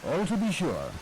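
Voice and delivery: usual speaking voice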